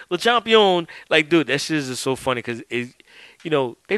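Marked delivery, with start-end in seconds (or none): 0.1-0.9 higher, louder mock announcer call; Tony Schiavone. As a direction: belt out Le champion like a Southern commentator. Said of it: "Tony Schiavone saying".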